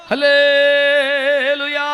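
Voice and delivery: slow, drawn-out, loud hallelujah; preacher leading the crowd in a loud hallelujah. Real, not acted. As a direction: stretch it out and fill the hall. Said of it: "గట్టిగా స్తోత్రం చెప్పండి అందరూ".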